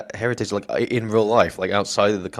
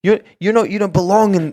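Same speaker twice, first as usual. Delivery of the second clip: louder, higher retold scolding; mock laying into him; 8 semitones higher, 5 dB louder than usual. Co-host retells Roman laying into Cody, mildly scornful. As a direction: imitate a wrestler tearing into a rival. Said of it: "laying into him, saying".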